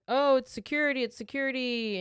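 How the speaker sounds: lightly acted, high guard voice; insistent guard at door. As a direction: announce yourself to someone behind a door. Voices guard calling through door.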